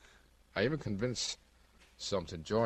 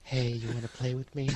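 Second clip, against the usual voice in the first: [name] impression; 5 semitones higher than usual. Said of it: "doing [name] impersonations".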